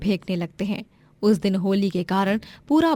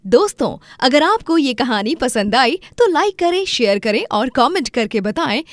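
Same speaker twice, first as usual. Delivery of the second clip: loud, lively appeal to viewers; upbeat channel outro; 5 semitones higher, 5 dB louder than usual. Acted. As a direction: urge the audience on energetically. (जोशीली दर्शकों से appeal)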